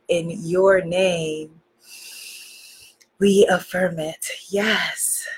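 devotional opening prayer, low and unhurried; heartfelt prayer. Real, not acted.

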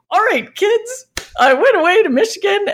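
high, hyped-up announcement to the team; mock big-idea boss announcing; excited boss pitch. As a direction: sell it to the kids with gusto.

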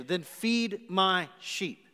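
slow command. Retold or imitated. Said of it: "A sheepish answer".

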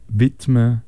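ordinary talk

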